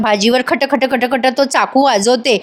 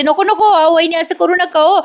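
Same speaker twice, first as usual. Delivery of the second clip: higher, urgent begging to stop; 6 semitones higher than usual. Acted. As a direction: rush in and plead for her husband. Wife rushes in begging.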